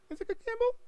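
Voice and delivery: falsetto squeak. Pretend. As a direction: squeal it like a cartoon. Playful echo game.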